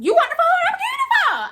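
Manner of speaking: very high, loud, laughing sarcasm; imagined snapping at the customer, squeaky and laughing; mock sarcastic offer. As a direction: shove the phone at her with exaggerated sass.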